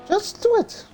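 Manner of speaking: put-on high falsetto girl voice; squeaky high-pitched heroine; Mulan voice.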